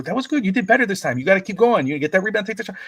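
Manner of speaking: high, upbeat praise; encouraging coach. Retold or imitated. Coach praising the boy's comeback, high and upbeat. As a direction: cheer him on, voice up high. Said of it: "I go".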